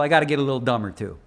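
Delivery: ordinary delivery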